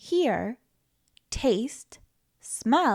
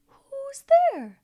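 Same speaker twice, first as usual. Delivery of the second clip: echo; 14 semitones higher than usual. Acted. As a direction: echo it back from far away. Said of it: "we have an echo in here".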